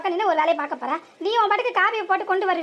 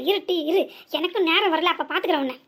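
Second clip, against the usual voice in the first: ordinary talk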